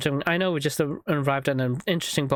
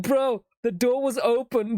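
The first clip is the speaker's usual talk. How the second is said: slow, higher, far-off dude voice; mock exasperated partner; deadpan bro; 8 semitones higher, slower than usual. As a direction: point out the obvious, slowly. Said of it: "It's like".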